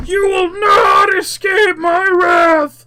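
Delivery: high, very loud, drawn-out roar; furious elemental roar. Acted. Elemental threatens the fleeing hero, furious roar. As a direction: roar it slowly at full force. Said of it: "elemental roars furiously".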